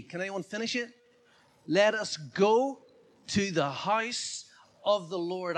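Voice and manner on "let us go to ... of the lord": slow, loud declaration; proclaimed scripture. Shown as a demonstration. Preacher finishing the psalm for the congregation, loud and slow. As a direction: proclaim it slowly and loudly, word by word.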